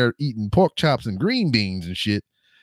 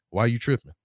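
deadpan. Retold or imitated. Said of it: "she was deadpan, dead ass, just like".

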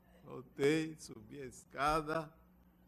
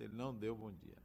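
usual speaking voice

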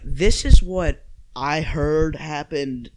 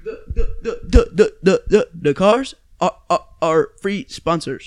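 higher, louder stuttering mockery of a friend; mocking stutter imitation; 4 semitones higher, 5 dB louder than usual. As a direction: mimic him, tripping over each first word. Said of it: "I didn't sound like that".

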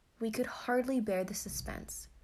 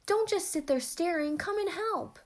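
high, loud, frantic scolding; urgent cry; 10 semitones higher, 5 dB louder than usual. A narrator's reading. Half-sister to the staring children, frantic high cry. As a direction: order the onlookers to help, urgently. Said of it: "cried the ancient half-sister".

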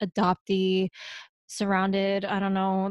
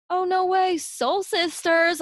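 high mock-excited exclamation, last words drawn out; gushing excitement; 9 semitones higher, slower than usual. Retelling her reply to the interviewer, mock excitement. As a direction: gush in a high, put-on thrill.

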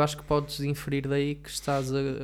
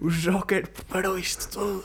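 squeaky high mock Trump; lightly pitched-up Trump imitation; Trump impression; 7 semitones higher than usual. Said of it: "Adorei a tua imitação do Trump".

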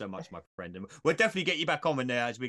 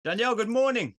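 mock greeting; 9 semitones higher, 6 dB louder than usual. Greeting guest in put-on voice.

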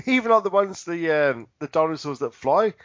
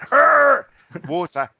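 loud roar dropping to dismay; T-Rex roar; 6 semitones higher, 6 dB louder, much slower than usual. Acted. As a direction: charge roaring, then freeze. T-Rex roaring then spotting water.

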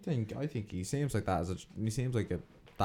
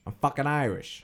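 higher, punchy Boston Irish; Boston Irish accent; 5 semitones higher than usual. Pretend. Friend trying out accents for a laugh, Boston Irish accent. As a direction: proudly declare it in mock Boston Irish. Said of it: "Or Boston Irish".

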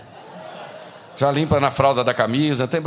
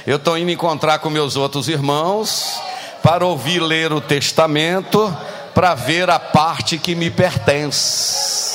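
loud, slow mocking repeat of her words; hammed-up slow shout of the quoted excuse; 2 semitones higher, 4 dB louder, slower than usual. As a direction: bellow her excuse back, drawn out.

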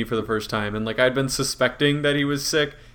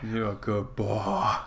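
puppy dog talk; 4 semitones lower, 8 dB quieter, slower than usual. Acted. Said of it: "puppy dog talk".

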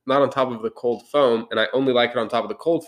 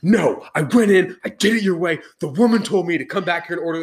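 snapping at barista; 5 semitones higher than usual. Retold or imitated. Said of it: "I'm like".

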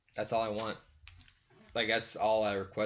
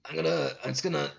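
raised, put-on cartoon scientist voice; Rick attempt; 4 semitones higher than usual. Friend settling for an unconvincing impression, mock cartoon scientist. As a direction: talk on in a knowingly bad grandpa impression. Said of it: "the voice like this".

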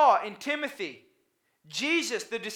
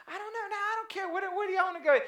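much higher, fast and half-laughing; mock indecisive friend; 9 semitones higher, 3 dB quieter, much faster than usual. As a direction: toss the question back in a high, quick, giggly voice.

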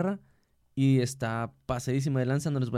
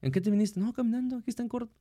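higher-pitched imitation of puzzled coworkers; lightly hammed-up surprise, higher voice; mock incredulous exchange; 8 semitones higher than usual. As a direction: act out casual disbelief, pitched well up.

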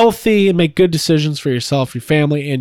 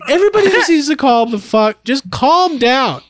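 higher, pleading, slowing down; exasperated plea; 8 semitones higher than usual. Real, not acted. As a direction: beg everyone to calm down. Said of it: "exasperated plea".

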